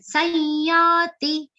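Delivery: slow Sanyati with nasal anuswara; nasal pronunciation. Shown as a demonstration. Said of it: "pronounced like a nasal".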